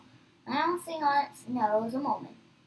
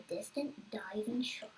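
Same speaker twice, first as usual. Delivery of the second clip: whisper; 3 semitones lower, 10 dB quieter than usual. Acted. Suspense moment in read narration.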